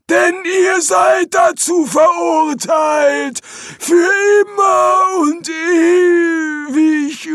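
high, very slow shouted doom; doom-laden demon. Acted. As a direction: bellow the sentence out. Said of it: "Er zögerte und wimmerte leise".

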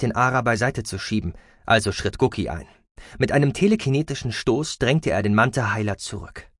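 usual speaking voice

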